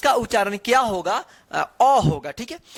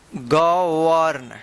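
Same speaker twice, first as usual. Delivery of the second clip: Govern stretched out syllable by syllable; slow, lower-pitched govern for learners; sounded out slowly; 4 semitones lower, much slower than usual. Shown as a demonstration. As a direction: draw govern out as 'ga... warn'. Teacher repeats word drawn out.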